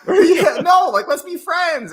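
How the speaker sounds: very high, loud peace offering; mock pleading. Teenager offering friendship to bullies, high mock plea. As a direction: squeak out an earnest appeal.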